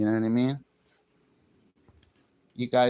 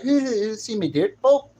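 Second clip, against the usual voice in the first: high-pitched imitated Englishwoman; mock English accent; 8 semitones higher, 5 dB louder than usual. Streamer imitating English women he likes, mock English accent. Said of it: "I like English women sounding women".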